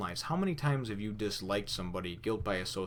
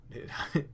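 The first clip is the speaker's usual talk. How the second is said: unvoiced laugh; 6 semitones higher than usual. Real, not acted. Laughing at Zoro surviving.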